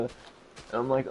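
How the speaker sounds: normal talking voice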